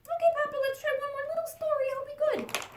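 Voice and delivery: high, eager little chick voice; little chicken voice. Acted. Chick asking her papa for a bedtime story, high and eager. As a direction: squeak it up like a small excited chick.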